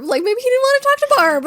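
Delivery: high, loud playful voice; high excited. Real, not acted. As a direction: toss out a joking theory. Joking defense of Nash.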